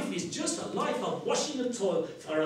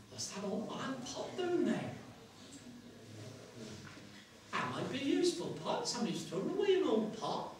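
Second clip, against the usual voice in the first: put-on worn-out old woman; 7 dB quieter, faster than usual. Washerwoman eyes an old pot by the hedge, old and worn out. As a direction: wonder aloud as a weary old crone. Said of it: "how old and worn out she is".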